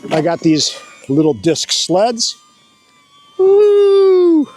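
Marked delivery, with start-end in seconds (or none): 3.4-4.5 high, loud whoop going down the hill; excited whoop. Real, not acted. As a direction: let out a sledding whoop. Sledding down the hill.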